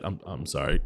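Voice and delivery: ordinary delivery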